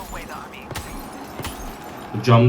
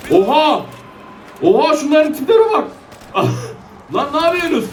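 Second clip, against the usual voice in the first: high astonished cry into laughter; stunned then laughing at zombies; shocked laugh; 10 semitones higher than usual. Real, not acted. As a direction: gasp at the crowd. Zombie crowd appears.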